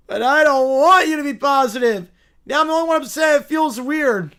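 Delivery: loud petulant whine, easing off; bratty, put-out whining; whiny complainer. Acted. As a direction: protest like an upset child. Plays upset whiner.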